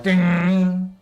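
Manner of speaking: high bright ding; imitated ding sound. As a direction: chime it out like a game sound. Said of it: "it goes like".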